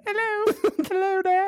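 squeaky-high mock little girl; hammed-up falsetto child voice; young girl impression. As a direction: pitch it way up like a small girl. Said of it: "impression of a young girl".

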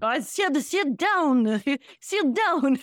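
high, mock-scolding senator impression; Bernie Sanders. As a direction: play a senator with a French slant. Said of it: "Even, like, Bernie Sanders".

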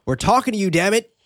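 irritated shout into an empty place; character's angry call. Acted. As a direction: call out in mock exasperation. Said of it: "Yavuz adding, quote".

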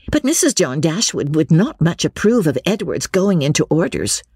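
high-pitched Lucy raising a worry; Lucy, objecting. Acted.

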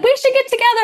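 squeaky high eager voice; high chirpy invite. Retold or imitated. Co-host quoting locals inviting her, high and eager. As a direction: pitch it way up like an excited friend. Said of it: "People are like".

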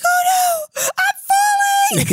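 very high, slow cry of alarm; hammed-up cry of someone sliding down; mock panicked wail. Imitating patient sliding from car.

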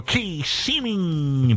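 city name held long for show; drawn-out announcer flourish. Pretend. Host hyping the call-in cities to listeners, drawn-out announcer flourish. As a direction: stretch the name out very slowly, radio-announcer style.

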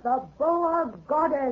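slow, distant, ominous pronouncement; otherworldly goddess. Acted. Bride declaring herself the goddess to her groom, slow and ominous. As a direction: speak like a god passing sentence.

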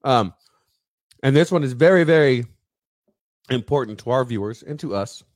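talking around a cherry; mouth full. Real, not acted. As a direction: speak through a mouthful. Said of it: "talk with my mouth full with a cherry".

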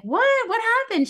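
high squeaky shocked What, loud; shocked disbelief. Retold or imitated. Telling how she reacted to the head of school, high shocked squeal. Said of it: "And I was like".

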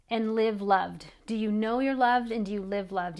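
usual speaking voice

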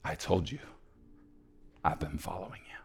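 very soft, near whisper; hushed tenderness. Acted. Old man reassures the freed orphan, almost whispering. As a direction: let it fall to a hushed murmur.

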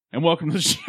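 stifled giggles. Real, not acted. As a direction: giggle breaking the intro. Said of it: "your nose is trying not to laugh".

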